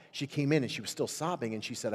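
ordinary talk, nothing marked

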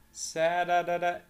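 "سا-دا-دا-دا" with a quick d-like flap for each t; American flap t drill; flapped t syllables. Shown as a demonstration. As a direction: tap out "سا-دا-دا-دا" syllable by syllable, the t as a fast flap. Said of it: "تي دي سريعة".

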